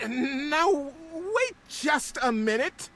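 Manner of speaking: high, slow trilling protest; elder protesting to Beaver and Builder; trilled. A narrator's reading. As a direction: trill the objection slowly. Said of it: "trilled the longest".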